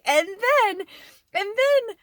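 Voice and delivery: high, loud, drawn-out anticipation; excited build-up. Real, not acted. Telling viewers the best part, real excitement. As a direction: stretch it out high, bursting to tell.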